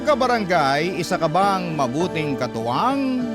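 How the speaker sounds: ordinary delivery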